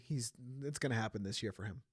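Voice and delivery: normal talking voice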